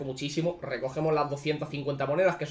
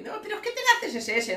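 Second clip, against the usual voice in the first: much higher put-on voice for a know-it-all; high mocking voice of a know-it-all chat; mock quote. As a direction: mimic the know-it-all viewers. Mocking what others tell him.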